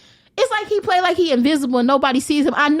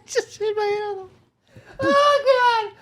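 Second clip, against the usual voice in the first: hurt whimper; 6 semitones higher, slower than usual. Real, not acted. Whimpering after hitting head.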